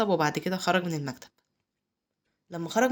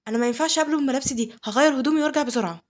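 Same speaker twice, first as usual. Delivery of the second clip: higher, louder, rushed protest; hurried refusal; 6 semitones higher, 5 dB louder, faster than usual. A narrator's reading. Girl rushing off to change clothes, flustered. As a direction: blurt it out in a fluster. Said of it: "راحت قامت بسرعة".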